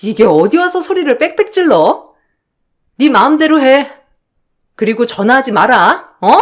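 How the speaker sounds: husband shouting at his wife over the phone, angry; husband yelling on the phone. Acted. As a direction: snap at her down the phone line. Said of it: "전화로 그렇게 소리치던".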